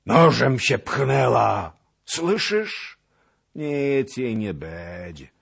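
high, loud, slow anguish; anguished outburst. A narrator's reading. Cossack despairing over the girl's stabbing. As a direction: cry it out in despair.

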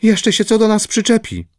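high, timid worried voice; frightened girl's high voice; fearful warning. A narrator's reading. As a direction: warn them anxiously, pitch well up. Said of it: "poczęła wołać bojaźliwa".

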